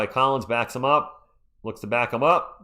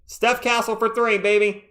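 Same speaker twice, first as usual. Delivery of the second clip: excited yell; 9 semitones higher, 3 dB louder than usual. Real, not acted. Live call of a three pointer.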